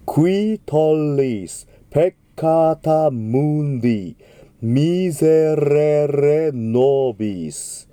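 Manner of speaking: slow, stretched Latin phrase; slow model diction. Shown as a demonstration. Teacher modelling the text slowly. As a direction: draw out ミゼレレノビス. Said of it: "クイではなくてクイです".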